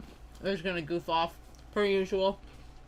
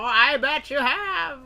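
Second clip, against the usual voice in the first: loud, high hype; rising hype at a big hit; hyped; 4 semitones higher, 7 dB louder than usual. Real, not acted. Hyped reaction to big pull.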